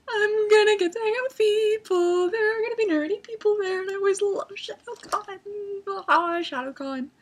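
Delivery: high, loud squeal of anticipation; giddy excitement. Retold or imitated. Fan squealing to herself about nerdy people, giddy. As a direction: gush in a high, over-excited mock wail.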